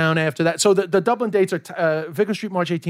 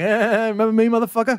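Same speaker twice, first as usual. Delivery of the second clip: mock old-timer taunt; 5 semitones higher than usual. Future [name] taunting the host at sixty, put-on mocking voice. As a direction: taunt the younger guy from old age. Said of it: "being like".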